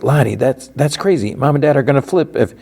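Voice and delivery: high-pitched, retold worried protest; alarmed protest. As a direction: object like an alarmed younger brother. Brother protests a risky trade.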